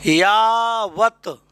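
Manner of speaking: यावत stretched out very slowly to show its parts; slow spelling demo. Shown as a demonstration. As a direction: draw out यावत for the class. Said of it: "देखो इसको ऐसे". (यावत को बहुत धीरे खींचकर बोलें)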